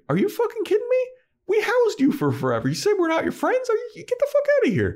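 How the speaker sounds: very high squeaky imitation of indignation; mock outrage. Xander and Anya reject Spike asking for help, mock outrage. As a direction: act out incredulous outrage in a tiny high voice.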